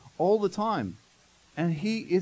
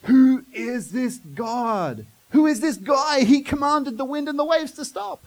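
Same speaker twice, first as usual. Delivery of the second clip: higher, louder, awestruck; amazed; 7 semitones higher, 6 dB louder, slower than usual. Retold or imitated. Disciples marvelling at Jesus after the storm, loud and amazed. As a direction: marvel at what they just saw. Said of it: "They're amazed".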